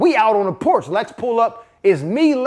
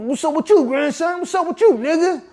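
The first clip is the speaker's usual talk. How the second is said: put-on much higher, teasing old-man call; mock the grandson with a knowing sing-song; grandfather's voice; 9 semitones higher than usual. Grandpa catching grandson sneaking, higher teasing voice.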